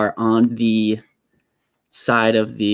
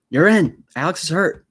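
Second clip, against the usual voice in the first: a hint of an urgent coach, raised pitch; lightly voiced coach; 7 semitones higher, 3 dB louder than usual. Coach sending in the backup quarterback, lightly urgent order. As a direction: deliver it as a coach's quick order.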